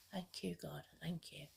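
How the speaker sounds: relieved whisper to God; whispered thanks. Real, not acted. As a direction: breathe a grateful whisper. Relief prayer on finding bag.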